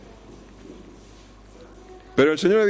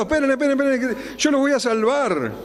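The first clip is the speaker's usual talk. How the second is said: hurried, higher, frantic pleading; mock frantic; 7 semitones higher than usual. Preacher mocks a frantic Lord rushing to rescue, high frantic voice. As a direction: play a panicked rescuer. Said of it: "corriendo, desesperado".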